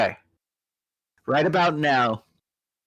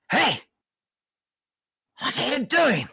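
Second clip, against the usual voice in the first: high, sharp guard's shout; Yuan-ti guard; 12 semitones higher than usual. Acted. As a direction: snap at the prisoner like a snake-man guard. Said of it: "the Yuan-ti here is like".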